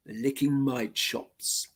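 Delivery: ordinary delivery, nothing marked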